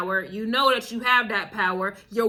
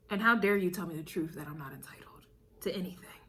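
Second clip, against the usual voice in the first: whispered, fast mock outrage; 6 semitones lower, 8 dB quieter, faster than usual. Whispering the offended customer's outrage. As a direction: hiss it like a scandalised aside. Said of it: "That's the vibe".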